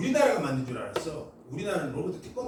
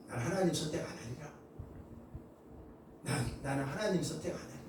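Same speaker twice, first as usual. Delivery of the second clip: hardened inner vow; 3 semitones lower, 10 dB quieter than usual. Retold or imitated.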